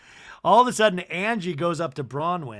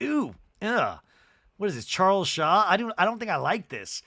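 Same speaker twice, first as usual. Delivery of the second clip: disgusted Mary; 5 semitones higher, 3 dB louder than usual. Retold or imitated. Mary tasting the drink, disgusted.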